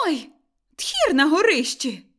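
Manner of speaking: loud, startled exclamation; sudden loud alarm at the noise; alarmed cry. A narrator's reading. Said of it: "сказала Кайдашиха".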